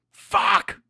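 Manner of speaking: furious shout. Real, not acted. Streamer losing his temper at fans, furious shout. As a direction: explode with the word Fuck as the rant boils over. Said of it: "I get upset".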